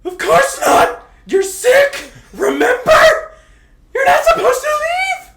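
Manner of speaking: high shrieking yell, laughing through it; shrieked scolding. Acted.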